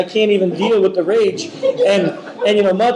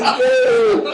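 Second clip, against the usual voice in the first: much higher, squeaked through a laugh; squeaky laughing. Real, not acted. Blaming his friend Dave, squeaked through laughter.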